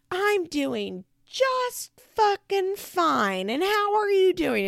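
high, drawn-out mock rage; retold furious outburst. Rachel blowing up at her mom in a fight, furious mock shriek. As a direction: lose it, stretching the words high. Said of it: "just losing her absolute mind".

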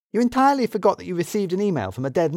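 lightly voiced woman, amazed; incredulous Edie.

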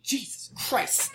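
whispered under the breath; exasperated whisper. Real, not acted. Exasperated aside.

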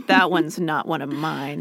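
low knowing voice. Retold or imitated. Stubby voiced, lower.

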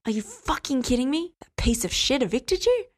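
indignant, acid fury; caustic anger. A narrator's reading. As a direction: demand it in scathing disbelief. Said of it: "Dean's voice could have corroded metal".

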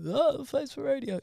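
high mock exclamation; mocking quote. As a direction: mimic everyone's tired old jibe. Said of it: "everyone would go".

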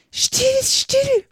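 very high, anxious hushing; hushed, urgent rabbit. A narrator's reading. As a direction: hush her in a tiny high voice. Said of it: "in einem leisen, schnellen Tone". (ängstliches Kaninchen, sehr hohe Stimme)

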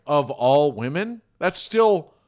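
loud rising incredulous retort; exasperated retort. Retold or imitated. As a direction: push back with disbelief. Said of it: "I'm like".

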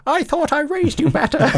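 high mock parent voice; disappointed parent. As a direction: scold like a let-down dad.

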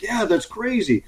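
high-pitched amazed reply, lightly acted; imitating a party guest's disbelief; amazed stranger. Party guest realizing who he is, high amazed reply. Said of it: "He's like".

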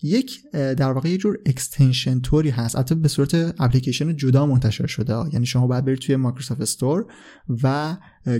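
ordinary talk, nothing marked

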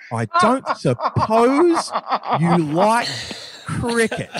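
slow, high and loud, stretched in disbelief; mocking echo with a laugh; incredulous repeat. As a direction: repeat his line as if it's absurd. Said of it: "he was like".

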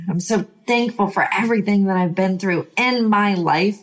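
ordinary talk

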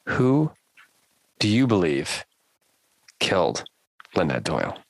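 ordinary talk, nothing marked

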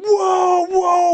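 high, loud drawn-out wail; retold alarmed cry. Sparring partner whacked on the forehead, wailing in alarm. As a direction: imitate a stunned man crying out. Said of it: "And he went".

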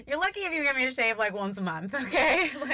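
laughing, high and loud; giggling through the words. Real, not acted. Laughing about shaving.

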